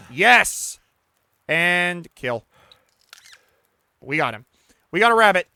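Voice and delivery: loud victorious shouts; triumphant cheer. Real, not acted. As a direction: celebrate the catch. Catching the rabbit.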